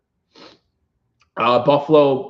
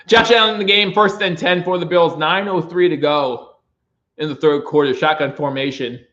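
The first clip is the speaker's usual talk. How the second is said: brisk sportscaster call of the drive; play-by-play call; 4 semitones higher than usual. Acted. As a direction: call the snap like the radio guy. Fan streamer calls the game.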